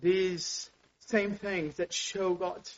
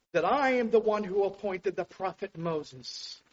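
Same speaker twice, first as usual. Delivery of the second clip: ordinary delivery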